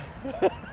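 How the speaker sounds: short high, soft laugh; real chuckle as the fit dies down; small after-laugh; leftover chuckle. Real, not acted.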